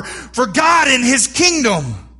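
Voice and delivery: slow, high, loud rallying cry; rally the army; battle cry. Pretend.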